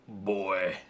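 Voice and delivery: low mock-gruff fatherly "boy"; Kratos. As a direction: drop it low like a game warrior. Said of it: "like, uh, Kratos in".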